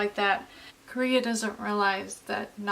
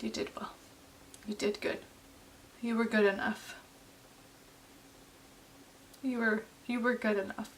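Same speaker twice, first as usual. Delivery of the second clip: much softer, hushed comfort after a sigh; tender, hushed; 7 dB quieter than usual. Real, not acted. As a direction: say it barely above a breath, tenderly. Soft repeat to Kim.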